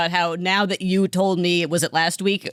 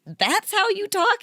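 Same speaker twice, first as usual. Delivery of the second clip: much higher, slow, amazed; stunned disbelief; 12 semitones higher, slower than usual. Retold or imitated. Hearing his real accent, high slow disbelief. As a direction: drag it out in shock. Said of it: "And I was like".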